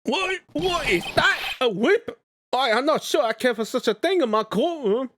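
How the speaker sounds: flustered Canadian judge; Canadian accent. Acted. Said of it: "Canadian judge".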